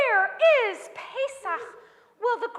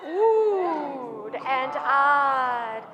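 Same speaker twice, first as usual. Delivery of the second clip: drawn-out oohed; 8 semitones lower, much slower than usual. A narrator's reading. Drawing out the crowd's wonder.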